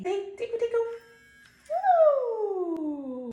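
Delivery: high playful. Real, not acted. Tickling game with bird.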